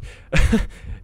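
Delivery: high breathless nervous laugh; shocked laugh. Real, not acted. Streamer laughing nervously after a scare, breathless. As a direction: laugh off the fright.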